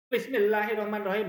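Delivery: high, loud regional-accent Bismillah; regional accent demo. Shown as a demonstration. Host shows a disallowed way of reciting, loud regional accent. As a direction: belt out Bismillah in an exaggerated local accent. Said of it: "logat apa gitu".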